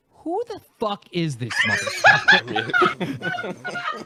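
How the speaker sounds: very high, slow yelled outrage; outraged shout. Retold or imitated. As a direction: shout it out in disbelief. Retold outraged call to friend.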